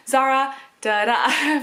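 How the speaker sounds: "Zara" then "da da", second "da" stressed; stress pattern demo. Shown as a demonstration. Mum showing her wished-for name rhythm, stress on last "da". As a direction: sound out "Zara da da", landing on the final "da". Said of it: "the emphasis on the second syllable".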